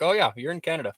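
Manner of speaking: loud, high Canadian Mountie voice; Canadian accent. Retold or imitated. Streamer playing the Mountie at the border, Canadian accent. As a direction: put on a hearty Canadian accent. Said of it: "really real Canadian accent".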